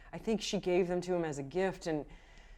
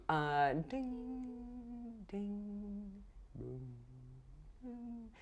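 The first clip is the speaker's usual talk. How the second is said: imitated bell tones; 3 semitones higher, 11 dB quieter, much slower than usual. GM imitating the earth's ringing tone for the player, softly fading. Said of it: "almost like bells ringing".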